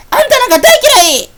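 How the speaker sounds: a shrieking, very high shout; tearful angry cry. Acted. Sakuya screaming at Meiling, tearful angry cry. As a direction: scream it at her in hurt fury.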